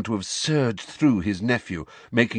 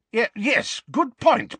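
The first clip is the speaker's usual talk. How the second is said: flustered uncle; 4 dB louder, slower than usual. Acted. Vernon flustered agreeing.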